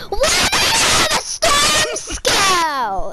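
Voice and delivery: high, drawn-out shriek of disbelief; surprised shout. Real, not acted. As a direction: yell in amazement, stretching it out. Exclaims at surprise weapon find.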